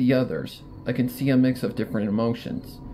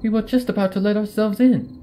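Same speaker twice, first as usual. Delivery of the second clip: raised, lively voice of a pony friend; character voice; 8 semitones higher than usual. Acted. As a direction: voice the worried friend brightly. Character voice in story.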